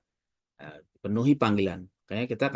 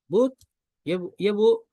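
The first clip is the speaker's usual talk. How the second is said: polite reply; 12 semitones higher, 4 dB louder than usual. Retold or imitated. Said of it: "sambutan yang baik".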